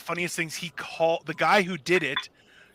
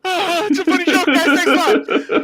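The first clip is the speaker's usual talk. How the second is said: higher and louder, laughing through mock tears; tearful fake cheer; 9 semitones higher, 7 dB louder than usual. Pranked man thanking the pranksters through tears, mock-sobbing. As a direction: choke out a bitter "funny joke" with a laugh. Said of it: "I'm just gonna go with my tears".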